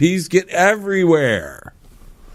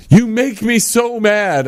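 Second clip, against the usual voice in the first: mock angry parent; 7 semitones higher, slower than usual. Reading the parent's phrase.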